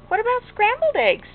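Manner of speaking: high, loud, eager question; eager suggestion. Acted. Father offering Frances another kind of egg, eager and loud. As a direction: pitch it up as a hopeful offer.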